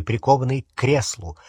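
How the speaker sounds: usual speaking voice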